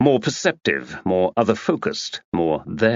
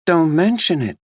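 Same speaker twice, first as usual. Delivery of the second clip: higher American socialite voice; American accent; 8 semitones higher, 3 dB louder than usual. A narrator's reading. Tina answering Max in a breezy American accent. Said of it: "She spoke in an American accent".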